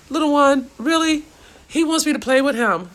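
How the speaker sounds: high, loud coaxing pet talk; talking to the dog. Real, not acted. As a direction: sweet-talk the puppy. Talks to her dog.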